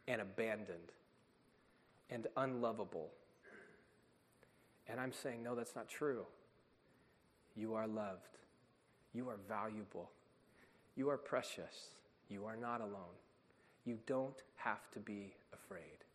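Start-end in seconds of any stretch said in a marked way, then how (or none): 5.4-16.0 softer and softer, ending almost in a whisper; hushed loving reassurance; tender affirmation. Retold or imitated. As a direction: soothe a frightened child.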